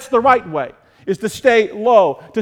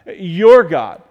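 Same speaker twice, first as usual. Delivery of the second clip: "your" punched as the contrast word; pointed, weighted "your"; stress on YOUR; 3 semitones lower, 4 dB louder than usual. Shown as a demonstration. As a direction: hit "your" hard, then drop on "God". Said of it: "Notice he didn't say his God".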